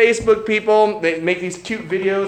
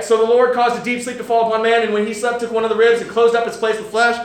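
a lightly raised, measured Bible reading; scripture reading; 4 semitones higher, 3 dB louder than usual. Acted. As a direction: read the passage out to the congregation. Reads Genesis passage aloud.

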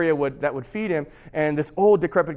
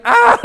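high, loud mock shriek of outrage; mad wife's shriek; 12 dB louder than usual. Old wife hears the silver is given away, mad shriek. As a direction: shriek like a furious old lady, hammed-up. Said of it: "She's really mad".

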